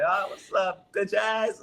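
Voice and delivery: a lightly voiced celebratory taunt; cocky taunt. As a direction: toss it off as a swaggering jab. Cheering taunt while blacked out.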